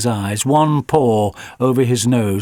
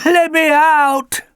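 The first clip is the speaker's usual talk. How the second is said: high, louder, slow pleading dog voice; barking dog; 6 dB louder, slower than usual. A narrator's reading. Alfie begs from the locked van, high slow dog voice. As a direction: bark it out as a desperate dog. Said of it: "barked Alfie".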